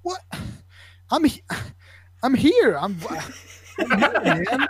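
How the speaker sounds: imitated kid, high and broken into bursts; high mock disbelief, as if answering an idiot; incredulous kid. Confused kid answering a concussion question, imitated high and incredulous. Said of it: "looking at me like I'm an idiot".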